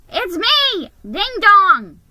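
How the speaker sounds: high, loud elf announcement; announced. A narrator's reading. Elf announcing himself at Santa's door, high and loud. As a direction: call through the door cheerfully. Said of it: "he announced".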